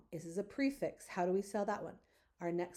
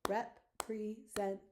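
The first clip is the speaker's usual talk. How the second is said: representative slowly chopped into five beats with gaps; syllable by syllable. Shown as a demonstration. Teacher counting syllables for the class, slow separated beats. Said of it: "Let's count our syllables".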